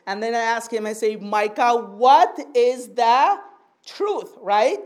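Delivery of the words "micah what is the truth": deliberate question, pausing before "truth"; stern parental questioning. Retold or imitated. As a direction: stress on "what", drawn out slowly before "truth". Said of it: "notice how I talk to him".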